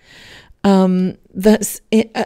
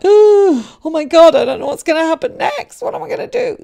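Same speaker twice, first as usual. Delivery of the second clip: much higher, louder, panicked cry; mock panic; 10 semitones higher, 8 dB louder than usual. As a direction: act out a hammed-up fear of change.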